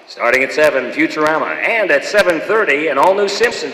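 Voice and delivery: loud hammed-up TV announcer; big dumb announcer. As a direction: boom it out like a cheesy network promo. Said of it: "the big dumb announcer for Fox".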